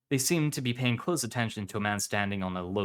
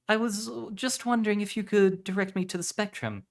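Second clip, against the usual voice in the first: much higher-pitched woman's voice; woman asking directions; 11 semitones higher than usual. Acted. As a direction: ask politely in a high put-on voice.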